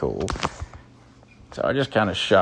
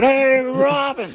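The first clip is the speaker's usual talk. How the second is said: excited; 14 semitones higher than usual. Retold or imitated. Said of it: "And I said".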